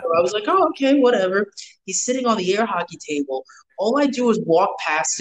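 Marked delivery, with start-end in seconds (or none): 0.5-1.5 high-pitched dismissive shrug; retold brush-off. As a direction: brush it off, voice lifted. Retelling his dismissive reaction.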